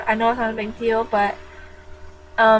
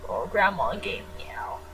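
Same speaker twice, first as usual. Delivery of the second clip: whisper; 3 semitones lower, 8 dB quieter than usual. Real, not acted. Whispered confession to viewers.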